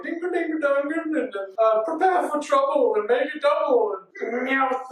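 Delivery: high singsong villain chant; Team Rocket motto. Retold or imitated. Villains announcing themselves, high singsong parody.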